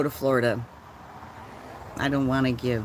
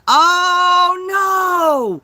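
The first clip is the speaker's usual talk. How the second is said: high, loud cry of alarm; shocked cry; 11 dB louder than usual. Real, not acted. Seeing the knocked-over plants, a high shocked cry. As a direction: gasp it out in horror.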